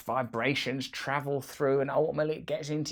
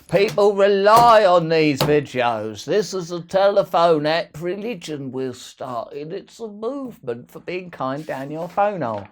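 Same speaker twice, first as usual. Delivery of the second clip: mock pompous rant; 5 semitones higher, 10 dB louder, slower than usual. Mock-grand rant at reflection.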